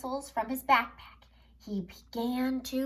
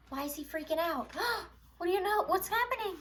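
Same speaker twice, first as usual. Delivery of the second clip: mock alarm; 8 semitones higher, 4 dB quieter, much faster than usual. Gasping, fast, excited questions.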